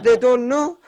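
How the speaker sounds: high, put-on Afghan accent. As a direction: finish the Afghan driver's point in his accent. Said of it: "I need to do the accent".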